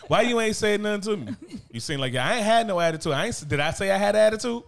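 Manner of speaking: high, loud defensive whine; imitating wife with attitude. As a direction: play the wife denying her attitude. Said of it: "She got".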